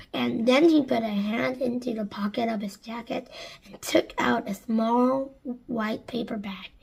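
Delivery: ordinary delivery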